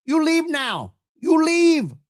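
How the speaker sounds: high, loud mock dictator voice, shouting the last words; mock Kim Jong-un. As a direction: bark out orders like a hammed-up dictator. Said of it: "Kim Jong-un behind her saying".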